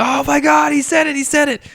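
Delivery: high, loud fan squeal; a theater crowd gushing, high-pitched and loud; excited fans. Retold or imitated. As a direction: squeal it like thrilled moviegoers. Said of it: "Everyone's like".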